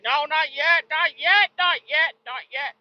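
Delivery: high, sing-song nagging; mocking in-law voice, high and repetitive; mocking nag. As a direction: mimic them like a fussy parent. In-laws mocked refusing.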